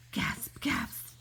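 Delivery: breathy whispered gasp gasp; airy panting gasps. Acted. Character out of breath.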